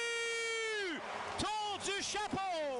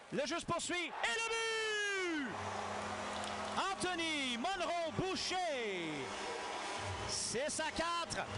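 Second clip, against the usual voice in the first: stretched, shouted goal call; excited goal call; 4 semitones higher, slower than usual. Real, not acted.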